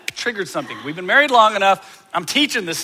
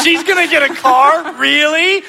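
high, loud, in disbelief; incredulous disbelief; 8 semitones higher, 4 dB louder than usual. Retold or imitated. Husband inwardly balking at buying his daughter a car, higher and louder. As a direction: scoff at the idea of her car.

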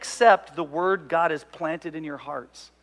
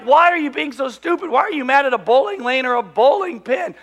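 loud, high incredulous questioning; retold scornful telling-off; exasperated challenge; 7 semitones higher, 7 dB louder than usual. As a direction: scold in disbelief. Voices an exasperated onlooker's challenge.

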